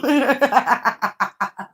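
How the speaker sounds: real staccato cackle; gloating laugh that tails off; mocking laugh. Real, not acted. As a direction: laugh it out in quick bursts. Laughs at the other streamer.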